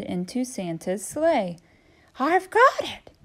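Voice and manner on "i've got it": high, bright realisation; excited turkey voice. Acted. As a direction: seize the new idea.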